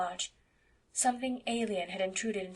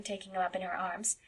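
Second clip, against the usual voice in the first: usual speaking voice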